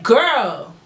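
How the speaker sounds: high, silly sing-song to the officer; mock flirty reply. Telling the officer a joke come-on, silly and high. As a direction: tease her with a goofy come-on. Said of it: "I'm like".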